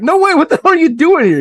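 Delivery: high, loud disbelieving yell; stunned shout. Retold or imitated. Telling the hosts about meeting Mark, a loud shocked shout. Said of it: "I'm like".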